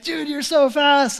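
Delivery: high, stretched-out admiration; impressed cheering. Retold or imitated. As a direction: hype him up, drawing the words out. Cheering the rider who won.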